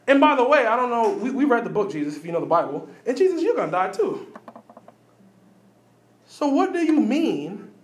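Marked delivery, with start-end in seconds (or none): none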